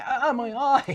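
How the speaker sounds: high, loud strangled cry of pain. Pretend. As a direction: yelp as if hit in the eye. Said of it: "a little strangled cry".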